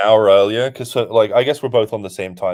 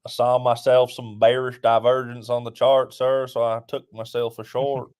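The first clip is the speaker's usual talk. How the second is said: put-on Southern gentleman trader; southern accent; 2 semitones higher than usual. Co-host telling sir about his short, southern accent. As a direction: play a Southern trader reporting in. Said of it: "your accent went straight southern".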